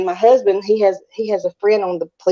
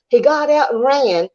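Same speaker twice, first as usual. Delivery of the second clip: ordinary talk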